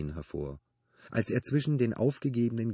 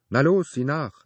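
higher, louder urging; encouraging; 8 semitones higher, 11 dB louder than usual. A narrator's reading. Benedikt urging Phoebe to look, encouraging. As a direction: urge her on with a loud, raised push. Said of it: "er ermutigte sie".

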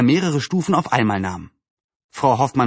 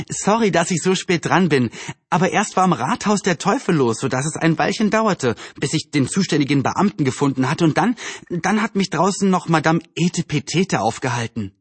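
put-on higher girl's voice, explaining herself; voiced Anne, apologetic; 4 semitones higher than usual. Anne explains to her friends why she is late, apologetic. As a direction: play a girl excusing her lateness.

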